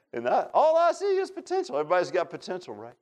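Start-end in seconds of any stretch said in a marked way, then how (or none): 0.1-1.8 high squeaky puppet voice; high-pitched cartoon puppet impression; Pinocchio voice. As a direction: mimic the commercial's Pinocchio, pitched way up. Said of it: "That's how he talked".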